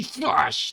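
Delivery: high vocal whoosh; comic-book attack noise; slash sound effect. Acted. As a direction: voice a sword swipe. Imitated sword attack noise.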